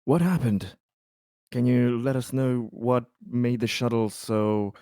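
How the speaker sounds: normal talking voice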